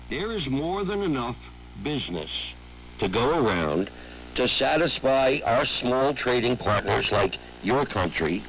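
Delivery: ordinary talk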